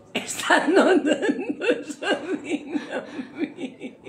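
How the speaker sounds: high, drawn-out laughing words; wailing laughter. Acted. Actress repeating how weak she felt, high laughing wail. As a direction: start loud and high, then stretch it shakily. Same line again, breaking up.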